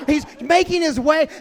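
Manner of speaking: loud laughing narration; laughing loudly. Retold or imitated. First telling, loud and laughing.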